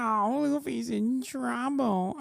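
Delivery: high, slow mock panic over a cartoon; mocking meme voice. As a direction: parody the meme clip's fake alarm, stretched out. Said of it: "I'm so edgy and funny".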